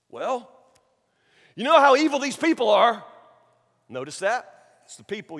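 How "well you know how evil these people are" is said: higher-pitched defensive excuse-maker; Aaron. Acted. Aaron excusing himself to Moses, defensive. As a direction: offer a lame excuse in a raised voice. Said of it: "What does Aaron say?".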